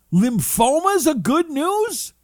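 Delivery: very high, loud, slow outrage; incredulous disbelief. Retold or imitated. Patient to doctor about lymphoma, high shocked disbelief. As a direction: stretch out the disbelief.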